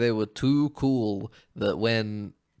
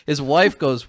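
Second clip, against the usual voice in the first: normal talking voice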